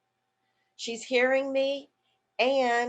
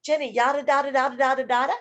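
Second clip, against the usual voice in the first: loud sing-song yada da da; rhythmic gibberish question; 3 semitones higher, 5 dB louder, faster than usual. Shown as a demonstration. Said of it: "What Jenny is hearing is".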